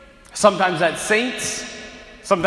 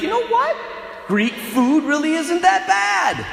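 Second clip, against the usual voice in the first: won over; 10 semitones higher than usual. Retold or imitated. Conquered people discovering they like Greek food, high excited. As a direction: gush like a delighted convert.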